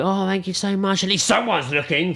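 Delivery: high, gushing mock thanks; imitated happy gushing. Drunk woman thanking helper, digging at boyfriend, mock gushing. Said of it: "she was very happy".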